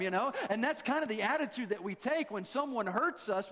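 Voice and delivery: normal talking voice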